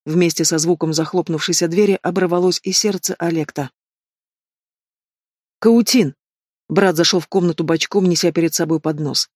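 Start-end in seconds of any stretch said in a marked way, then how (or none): 5.6-6.1 higher, louder call of a name. A narrator's reading. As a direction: call out to the brother with relief. Sister calls out to arriving brother. (радостный оклик брата)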